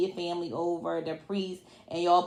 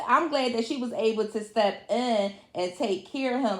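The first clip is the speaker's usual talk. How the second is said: ordinary talk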